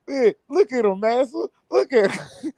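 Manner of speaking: high mocking voice, jeering at the dancer; mock crowd voice. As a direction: sneer it up high like a jeering spectator. Mocking onlookers at the jig.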